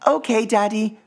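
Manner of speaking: high little cub voice; sleepy, eager little cub; child voice. A narrator's reading. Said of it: "murmured Little Pip".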